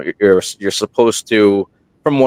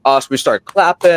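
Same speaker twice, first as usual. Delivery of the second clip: ordinary talk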